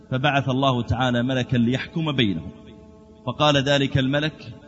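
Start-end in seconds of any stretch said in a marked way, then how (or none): none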